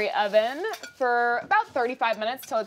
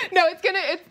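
laughing; 7 semitones higher, 4 dB louder than usual. Real, not acted. Host laughs at the huge meatloaf.

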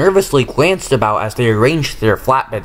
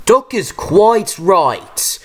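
booming controller voice; 7 semitones higher, much slower than usual. A narrator's reading. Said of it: "boomed a familiar voice".

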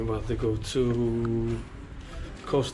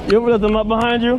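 loud call down the street; 10 semitones higher, 9 dB louder than usual. Real, not acted. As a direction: shout after her, teasing. Calling after wife who walked ahead.

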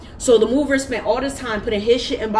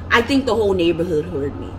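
ordinary talk

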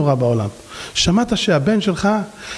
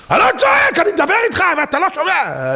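imitated shouting; 11 semitones higher than usual. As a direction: bellow the denial like an angry man. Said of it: "כבר נהיה לי חור באוזניים".

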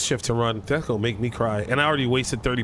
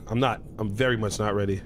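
ordinary talk, nothing marked

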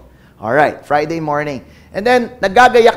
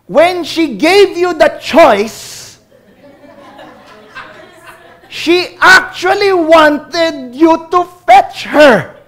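earnest daughter lecturing; 9 semitones higher, 6 dB louder, much slower than usual. Retold or imitated. Daughter scolding her father.

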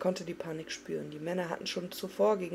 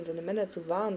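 plain reading voice

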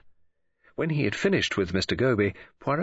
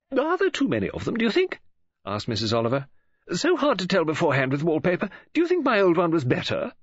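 bright, higher-pitched woman's voice; Mrs Oliver; 8 semitones higher, faster than usual. A narrator's reading. Novelist greeting Poirot on the phone, bright high voice. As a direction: play the chatty lady novelist, lifted and eager.

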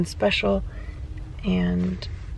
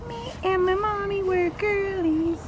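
voicing baby; 9 semitones higher, 6 dB quieter than usual. Mom speaking for baby Winnie to dad, in a high little-girl voice. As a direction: speak for the baby as a sweet toddler.

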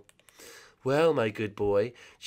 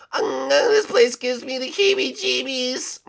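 nervous high voice; 4 dB louder than usual. Acted. Nervous character, high and slow.

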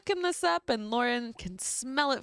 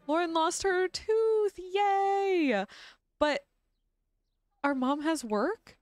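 normal talking voice

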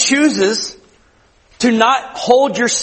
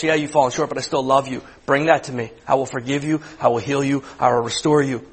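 gentle loving voice; 5 semitones lower, 4 dB quieter than usual. Retold or imitated. Pastor voicing the Holy Spirit to the church, soft and tender. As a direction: lower and softer, tender reassurance. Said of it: "It's a voice that tells us".

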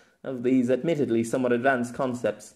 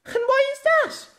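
much higher, louder, indignant demand; 6 dB louder than usual. A narrator's reading. Maka bristling at Pierre's doubt, high indignant demand. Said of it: "Maka returned indignantly".

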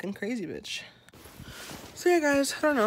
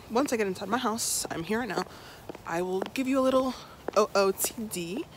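ordinary talk

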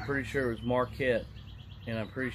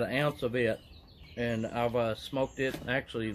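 speaking around a pipe stem; talking with pipe in mouth. Real, not acted. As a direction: lips clenched on pipe. Seen 2: Pipe stem between lips about 0.7–3.6 s, removed after.

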